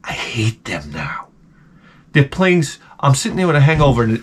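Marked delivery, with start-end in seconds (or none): 0.0-1.3 low, soft, slow admission; bitter aside. Acted. Comic confessing after the outburst about the band, quietly bitter. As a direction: drop right down, quietly resentful.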